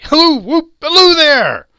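very high, loud halloo; joyful whooping. A narrator's reading. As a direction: holler with abandon. Scrooge whoops for joy.

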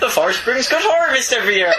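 Northern farmer. Retold or imitated. Co-host plays a farmer Vader praising the harvest. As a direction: brag about the harvest like a farmer.

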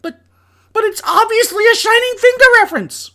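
high, loud mock-outraged whine; indignant protest. Host defends his joke to Harley, high loud protest. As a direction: argue back in shrieking disbelief.

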